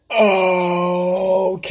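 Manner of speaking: loud, long-stretched 'okay' with attitude; mock drawn-out comeback. Goddaughter answering the boy's put-down, sarcastic. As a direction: stretch the word out before the comeback.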